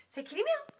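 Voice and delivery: very high sing-song request; pet talk. Real, not acted. Sitter asking the cat to meow in high baby talk.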